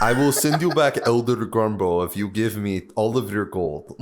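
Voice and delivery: put-on game-character voice; character scammer. Fake character bargaining for gold, mock solemn. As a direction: offer it as a hammed-up bargain.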